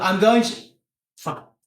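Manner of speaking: normal talking voice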